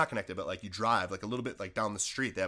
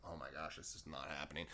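much lower and much softer, almost under his breath; hushed dismay; 8 semitones lower, 18 dB quieter than usual. Retold or imitated. Seeing practice already started, a low hushed murmur. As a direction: murmur it as the dread sinks in.

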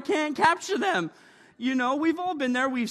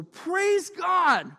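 drawn-out, lifted praise God; slow praise; 5 semitones higher than usual. Acted. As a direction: stretch it out like a shout of praise.

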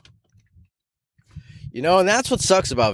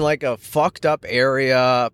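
ordinary talk

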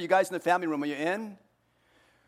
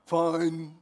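trite reply. Retold or imitated. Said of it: "this little trite thing that we usually say".

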